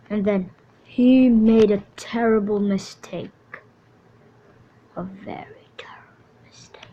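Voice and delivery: grave storyteller dropping to a hush; ominous narration. A narrator's reading. Narrator foreshadowing the hero's doom, ominous hush. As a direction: narrate the doom like a campfire tale.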